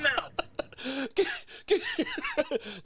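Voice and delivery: high laughter. Real, not acted. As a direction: burst of real laughter. Laughing at the clip.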